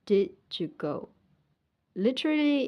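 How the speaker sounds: ordinary delivery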